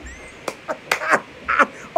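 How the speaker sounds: airy high cackle; high whispery cackle; high breathless laugh. Acted. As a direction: laugh without voice. Laugh after greeting.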